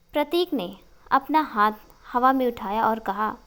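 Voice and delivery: usual speaking voice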